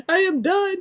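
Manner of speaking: real laughing fit breaking through; helpless laughter. Real, not acted. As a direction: say it while cracking up. Overcome by laughter at comment.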